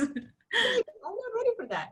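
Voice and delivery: slow, high, laughing protest; laughing disbelief. Real, not acted. New mother telling a colleague about the form, laughing in disbelief. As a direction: let it rise into a stunned laugh.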